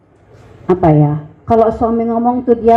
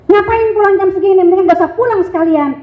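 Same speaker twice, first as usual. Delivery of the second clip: mock scolding; 8 semitones higher, faster than usual. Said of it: "teriak semuanya".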